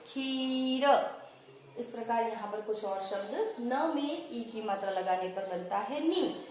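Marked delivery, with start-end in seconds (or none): 0.1-1.1 खीर very slowly, holding "khee" on one note; demonstrated whole word. Shown as a demonstration. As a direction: stretch खीर out for learners. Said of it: "उसका उच्चारण क्या होता है". (खीर में ई को खूब लंबा खींचें)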